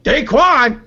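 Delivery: high, loud disbelieving "Daquan?"; incredulous echo. Real, not acted. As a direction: say the name as if you can't believe it. Host echoes name in disbelief.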